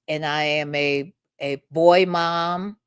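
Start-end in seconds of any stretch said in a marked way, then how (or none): none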